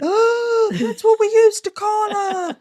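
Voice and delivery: the sisters' stunned recognition, slowed; astonished gasp. Retold or imitated. Retelling the sisters' amazed answer to the hosts.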